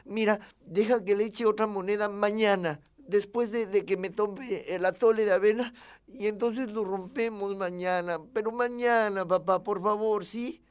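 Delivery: high, loud bargaining with dad; wheedling little boy's plea; pleading child. Acted. Child bargains with father for delay.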